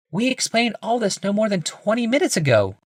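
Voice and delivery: a polite wife, lifted and high; Rebecca. A narrator's reading. Wife greeting her husband, high woman's voice.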